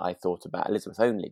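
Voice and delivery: ordinary talk, nothing marked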